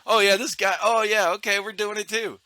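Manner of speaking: high-pitched follower imitation; mock eagerness. Other fields joining in the ban, mock eager. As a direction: parody people jumping on board. Mimics bandwagon followers again.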